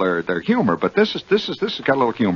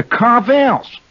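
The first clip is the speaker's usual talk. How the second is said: "Carvel's" raised and stretched, Jersey style; New Jersey accent; 12 semitones higher, 4 dB louder than usual. Shown as a demonstration. Host teaches the native language, stretched Jersey accent. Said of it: "that's the correct pronunciation".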